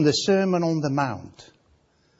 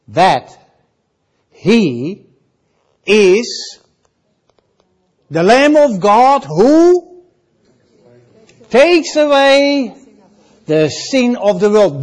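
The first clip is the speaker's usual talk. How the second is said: proclaiming; 4 semitones higher, 6 dB louder, slower than usual. Acted. Quoting John the Baptist proclaiming.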